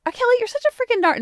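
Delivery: high, fast mock self-scolding; beating herself up. Woman talking to herself, mock scolding. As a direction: scold yourself in a squeaky rush. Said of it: "like, beating myself".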